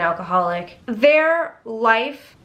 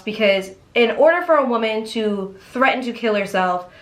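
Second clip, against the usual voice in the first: ordinary talk, nothing marked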